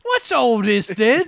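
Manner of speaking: loud, high comic English bobby line; mock English accent. Co-host mocking the acting attempt, loud mock English. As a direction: boom out a hammy English cliché. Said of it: "Why do you have an English accent?".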